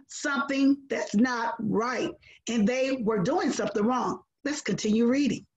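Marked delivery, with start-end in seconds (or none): none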